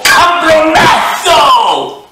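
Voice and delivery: high, loud, slow drawn-out yell; screeching involuntary curse; shouted swear. Pretend. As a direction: stretch the curse out at full volume. Mock tic outbursts of swearing.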